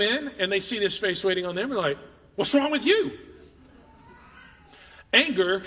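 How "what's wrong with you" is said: higher, louder pretend challenge; mock confrontation. Person walking in snapping back at the angry face, pitched up. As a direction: throw the question back, pitched up and pushy.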